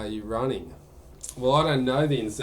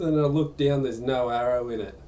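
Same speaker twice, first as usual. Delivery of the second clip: ordinary talk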